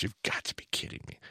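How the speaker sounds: a stunned whisper to the disciples; whispered disbelief. Retold or imitated. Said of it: "stares at his disciples in the eyeballs and goes".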